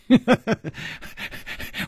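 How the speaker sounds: light airy tinkling laugh; silvery laugh. A narrator's reading. Masked woman reveals herself with a tinkling airy laugh. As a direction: laugh like little silver bells. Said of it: "银铃般的笑着说".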